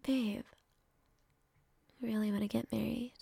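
ordinary talk, nothing marked

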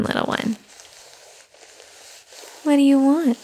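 ordinary talk, nothing marked

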